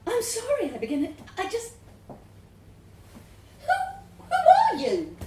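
bemused, rising into a laugh; baffled question breaking into laughter; laughing confusion. A narrator's reading. Confused woman asks the voice.

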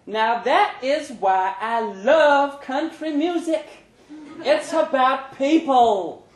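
a fan in the crowd raving slowly; gushing woman fan. Acted. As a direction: gush about the music.

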